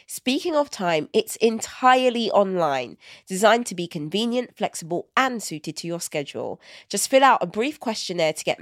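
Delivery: ad read. Acted. Host reading the BetterHelp ad to listeners, upbeat ad read. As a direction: sell it like a podcast ad.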